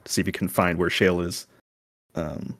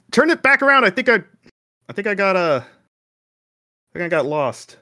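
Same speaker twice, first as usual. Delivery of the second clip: lost and panicking; 8 semitones higher, 3 dB louder than usual. Acted. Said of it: "I was gonna start shouting".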